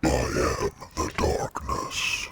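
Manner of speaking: slow, whispered demon growl; demonic layered voice. Acted. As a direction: intone it like a demon from the dark. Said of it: "talking in a demon voice".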